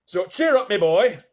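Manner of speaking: hearty northern English cheer-up; northern centipede. Acted. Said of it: "the centipede said".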